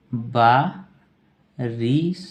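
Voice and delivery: बारिश stretched out very slowly; drawn out dictation. Shown as a demonstration. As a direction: spell out बारिश slowly for the class. Teacher dictating word slowly. (बहुत धीरे खींचकर बोला गया)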